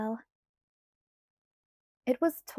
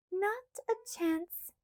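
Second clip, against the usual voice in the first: higher, slow, smug; gloating; 10 semitones higher, 4 dB quieter, much slower than usual. A narrator's reading. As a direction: gloat at Edward. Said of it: "Alice gloated".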